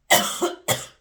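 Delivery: loud choking cough. Real, not acted. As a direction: cough as it goes down wrong. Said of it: "I went down the wrong way".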